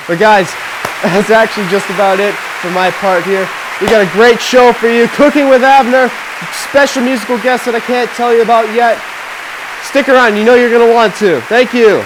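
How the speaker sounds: loud, high, hyped-up announcer delivery; big excited talk-show host energy; show host sign-off. Real, not acted. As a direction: pump up the crowd for what's coming. Host signs off to audience.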